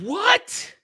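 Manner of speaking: high, loud, startled question; shocked what. Real, not acted. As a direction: blurt it out in disbelief. Shocked at betting on story.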